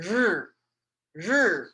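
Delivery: r held as a buzzing sound, tongue suspended behind the teeth; Chinese r sound. Shown as a demonstration. Teacher demonstrating the Chinese word for sun. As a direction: sound out the r slowly for the class. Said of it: "this word is pronounced".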